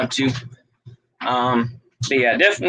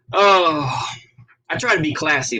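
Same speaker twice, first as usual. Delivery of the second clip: ordinary talk, nothing marked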